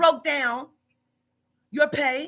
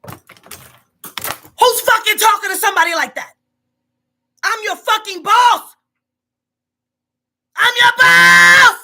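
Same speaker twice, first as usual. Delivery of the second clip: slow, much louder and higher yelling; furious shout; 7 semitones higher, 11 dB louder, slower than usual. Real, not acted. Boss yelling at disrespectful worker, furious shout. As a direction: bellow it out, outraged.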